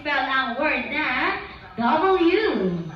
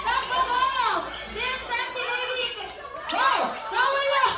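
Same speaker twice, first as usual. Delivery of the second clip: high-pitched excited calling out; excited shouting; 7 semitones higher than usual. Real, not acted. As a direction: cheer the players on, high. Host calling out a running game.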